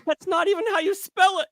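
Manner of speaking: very high, mock affronted squeak; indignant correction. Retelling correcting Social Blade's spelling, mock indignant and high. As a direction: scold it for spelling dead wrong. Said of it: "I'm like".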